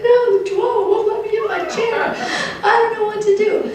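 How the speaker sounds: high, whiny mock old lady with a laugh; helpless old lady. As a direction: play the helpless old lady giving up her chair. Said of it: "She's like".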